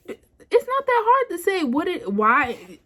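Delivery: high-pitched, exasperated retort. Retold or imitated. Woman retelling her plea for a reason, exasperated. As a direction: push it up high in frustration.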